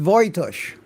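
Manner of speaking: Voitush as VOY-tush; Voitush, starting like voice; corrected name pronunciation. Retold or imitated. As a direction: give Voitush an OY sound, no J. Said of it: "It's pronounced".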